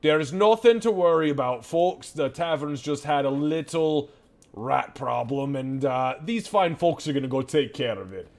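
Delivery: gruff tavern keeper. Acted. Keeper covering to patrons.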